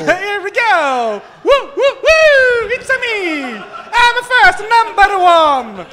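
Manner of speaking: high, loud, slow Italian-cartoon whoops; laughing Mario impression, shouted high. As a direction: cheer like Mario in a kart race. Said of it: "trop de Mario Kart".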